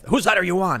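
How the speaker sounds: high, loud imitation of a confrontational demand; acted-out hostile questioning; mock angry challenge. Acts out hostile Antifa challenge.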